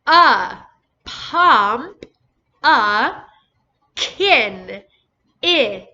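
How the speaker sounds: loud, separate A, Pump, A, Kin, I, with short vowels; teacher isolating each syllable and its short vowel; sounding out. Shown as a demonstration. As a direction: give A as uh and I as ih, each alone. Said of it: "What's the first vowel sound".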